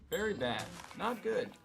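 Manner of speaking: ordinary talk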